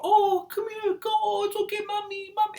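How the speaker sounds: put-on very high baby-talk coo; cooing grandma. Grandma soothing a baby, very high cooing. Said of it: "They'll call".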